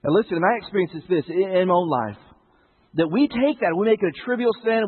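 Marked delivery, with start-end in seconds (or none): none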